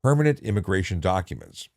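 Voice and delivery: ordinary talk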